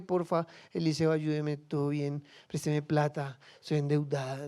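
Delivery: low, soft, offhand asking; casual half-hearted request. Acted. Preacher mimics how the woman did not ask, half-hearted. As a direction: toss off a timid little favor request. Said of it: "Ella no fue".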